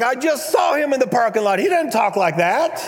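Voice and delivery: high-pitched incredulous reaction; baffled protest. Retold or imitated. Young churchgoer baffled by the man's prayer voice, high incredulous protest.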